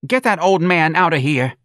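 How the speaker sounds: high, loud complaining mother; indignant mother. A narrator's reading. Mother demanding the policeman remove the old man, high and indignant.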